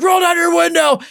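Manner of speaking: high shouted command; yelled demand. Retold or imitated. Road rager shouting at him at red light, high yell. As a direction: yell it at the car beside you. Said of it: "he's like".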